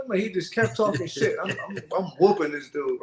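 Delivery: normal talking voice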